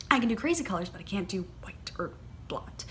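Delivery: hushed, breathy admission, dropping off at the end; confiding whisper. Real, not acted. Vlogger admitting she can't wear blonde wigs, whispering. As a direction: whisper it like a small confession.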